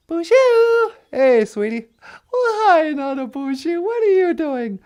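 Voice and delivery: high female voice. Pretend. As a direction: play the sweet girlfriend calling in, high. Voicing Natasha on radio.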